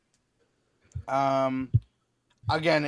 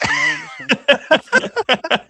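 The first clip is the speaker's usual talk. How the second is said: mock snooty insult; 9 dB louder, much slower than usual. Teasing mock insult to friend.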